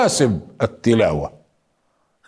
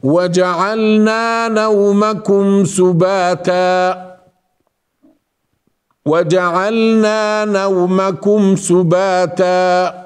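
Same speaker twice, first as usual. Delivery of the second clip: slow, melodic tajweed recital; measured Quranic chant; Quran recitation; 5 semitones higher, much slower than usual. Acted. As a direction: chant the verses steadily.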